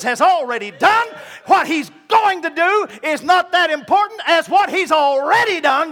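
loud, high, shouted sermon; full-force preaching. Acted. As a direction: preach it to the rafters.

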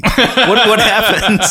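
laughing through the question. Real, not acted. Laughing at the co-host's joke, asks what happened. Laughing mid-banter with co-host.

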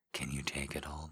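breathy close-mic whisper of the question. Shown as a demonstration. Lover teasing a partner, whispering. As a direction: drop to a whisper for it. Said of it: "repeats question as a whisper".

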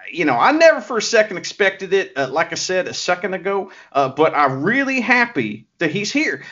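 put-on Southern accent, lightly done; a hint of a put-on American South accent; Master Wato Southern accent. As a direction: play a folksy Southern good-guy wrestler. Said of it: "he's putting on a voice".

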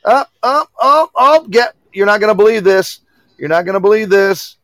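high, eager and loud; bursting with news; excited. Real, not acted. Excited announcement of caller.

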